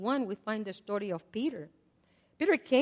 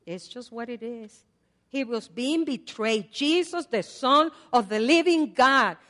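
ordinary delivery

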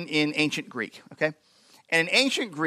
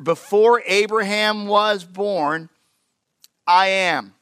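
slow solemn proclamation; 3 semitones higher, 4 dB louder, much slower than usual. Acted. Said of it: "Jesus answered".

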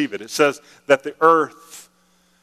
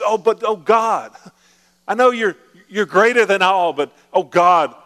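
higher, louder begging prayer; whiny pleading believer; 6 semitones higher, 4 dB louder than usual. Retold or imitated. Preacher mocking a whiny believer's plea. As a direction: plead with God like a desperate complainer. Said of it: "I'm talking like somebody who's talking like this to God".

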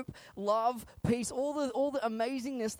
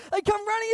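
high, loud mock child wail; 14 semitones higher, 8 dB louder than usual. Preacher mimicking children running to a parent, mock wailing. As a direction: imitate a kid running up screaming. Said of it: "crying and screaming like it's your fault".

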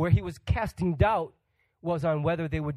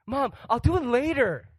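put-on kid putting off; 8 semitones higher, 4 dB louder than usual. Child promising mom again.

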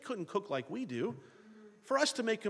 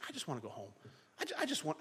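homesick sigh; 3 semitones lower, 8 dB quieter, much faster than usual. Retold or imitated. Voicing a homesick traveller.

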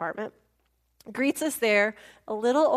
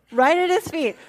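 laughing; 3 semitones higher, 6 dB louder than usual. Real, not acted. Laughing while telling.

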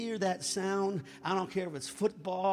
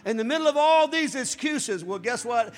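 swelling pulpit cry; preaching at full force; 2 semitones higher, 9 dB louder than usual. Acted. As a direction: build the fire toward the quote.

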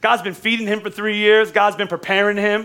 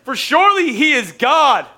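higher, louder, slow mocking call; taunting Elijah; 8 semitones higher, 5 dB louder, slower than usual. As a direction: jeer at them to shout louder. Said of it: "he says, he says".

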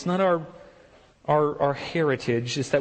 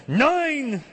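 German shout; 12 semitones higher than usual. Retold or imitated. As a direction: bark it like a German relative. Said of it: "Because I'm German".

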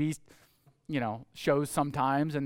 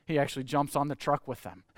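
ordinary talk

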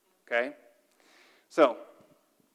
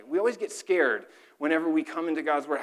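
ordinary talk, nothing marked

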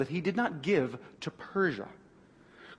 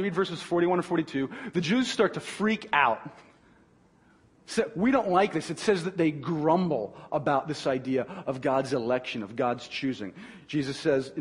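ordinary talk

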